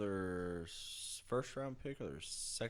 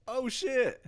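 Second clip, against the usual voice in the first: surprised; 8 dB louder than usual. Retold or imitated. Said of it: "He's like".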